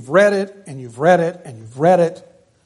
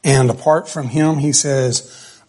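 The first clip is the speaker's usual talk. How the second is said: ordinary delivery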